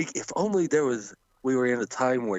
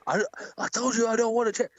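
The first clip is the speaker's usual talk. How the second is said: high, fast mock exasperation; exasperated mock quote; 11 semitones higher, faster than usual. Kevin Owens refusing the chair, mock-exasperated, high and fast. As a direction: toss off an impatient refusal. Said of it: "He's like".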